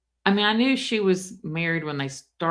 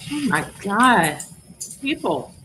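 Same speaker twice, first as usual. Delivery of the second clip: stunned disbelief; 4 semitones higher, 4 dB louder than usual. Real, not acted. Reacting to shocking message.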